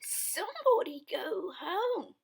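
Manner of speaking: put-on high, loud little baby voice; Baby Blair. Baby Blair reporting the bowl is empty, high baby voice. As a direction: chirp it out. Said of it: "said Baby Blair".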